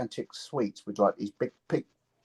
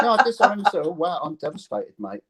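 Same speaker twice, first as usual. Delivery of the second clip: mock devastation; 6 semitones higher, 4 dB louder than usual.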